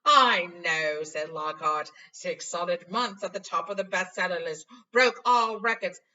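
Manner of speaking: loud, pleased boasting; boastful Lockhart. Acted. Vain teacher bragging to Harry, loud. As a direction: brag about the sales.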